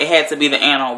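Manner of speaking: ordinary delivery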